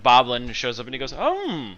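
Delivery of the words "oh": high, slow eager servant voice; much higher fussy manservant. Acted. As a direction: greet the master sweetly, drawn out. GM voices servant [name] greeting.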